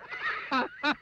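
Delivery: high, breaking-out belly laugh; hearty laugh. Acted. Narrator voices Mister Miserable's first laugh, hearty.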